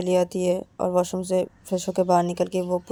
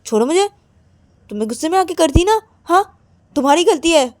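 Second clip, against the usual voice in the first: raised, louder accusing voice; angry blaming; 6 semitones higher, 6 dB louder, faster than usual. Acted. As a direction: scold a partner in a heated quarrel. Acted quarrel, one blames the other. (गुस्से में इल्ज़ाम लगाते हुए)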